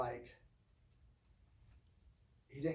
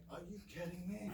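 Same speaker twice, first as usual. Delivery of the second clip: put-on high-pitched, disbelieving kid; acting out a disbelieving retort; incredulous protest; 5 semitones higher, 5 dB quieter than usual. Kid balking at her dad's order to face a bull, incredulous.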